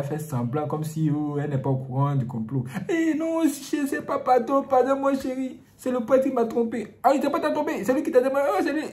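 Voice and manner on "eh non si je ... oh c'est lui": high, pleading fake innocence; lying wife. Wife lies, feigning innocence.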